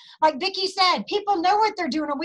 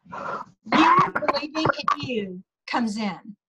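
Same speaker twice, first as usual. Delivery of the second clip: whispered; slower than usual. Real, not acted. Emphatic inspirational aside.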